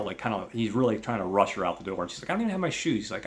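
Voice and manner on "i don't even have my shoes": high protesting voice. Retold or imitated. Woman objecting to rush.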